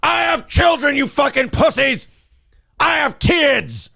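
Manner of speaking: high-pitched imitation of a raging rant; mock ranting shout. Host gleefully re-enacting the rant he just heard, mock shouting. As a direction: mimic an over-the-top ranter at full pitch. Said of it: "That's an Alex Jones level rant right there".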